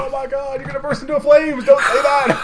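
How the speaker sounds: high, loud mock panic; mock alarm. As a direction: squeal it like a scandalized fan.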